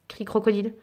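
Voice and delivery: plain reading voice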